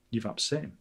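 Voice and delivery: ordinary talk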